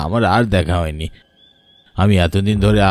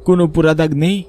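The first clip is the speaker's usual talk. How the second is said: agitated report; 7 semitones higher than usual. Acted. Servant reporting burned cows.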